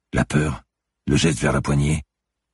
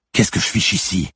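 higher, louder irritated complaint; grumbling; 8 semitones higher, 5 dB louder than usual. Acted. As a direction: snap the question out, annoyed. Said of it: "grogna Manon".

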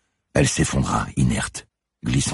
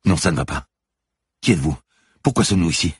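higher-pitched, alarmed questions; frightened woman, voice raised in pitch; 6 semitones higher, 4 dB louder, faster than usual. A narrator's reading. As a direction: ask it like you're scared. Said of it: "répondit Manon d'un air effrayé".

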